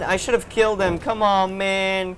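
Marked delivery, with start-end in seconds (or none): none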